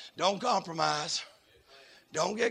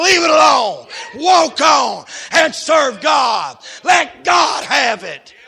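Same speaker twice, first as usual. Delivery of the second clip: loud, higher chanted preaching, slow on key lines; preaching at full force; 9 semitones higher, 13 dB louder than usual. Acted. Preacher chanting commands to the congregation. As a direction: hammer each command home.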